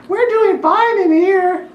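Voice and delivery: high, slow, sweet little halfling plea; cute halfling voice. Acted. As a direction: coax them to stay, tiny and sweet. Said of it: "This cute little halfling voice is like".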